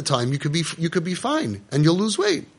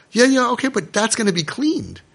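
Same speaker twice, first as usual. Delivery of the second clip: dismissive; 8 semitones higher than usual. Acted. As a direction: wave it away. Voiced dismissive reply.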